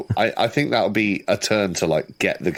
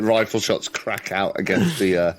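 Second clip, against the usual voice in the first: normal talking voice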